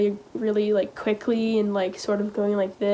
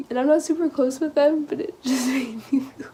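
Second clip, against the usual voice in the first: real tearful catch, higher voice; choking up; 6 semitones higher than usual. Real, not acted. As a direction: get choked up until the words give out. Choking up recalling friends' kindness.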